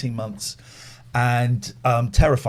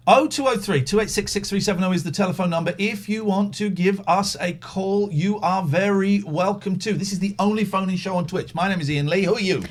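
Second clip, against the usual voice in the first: radio host; 6 semitones higher than usual. Acted. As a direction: announce it like a radio DJ.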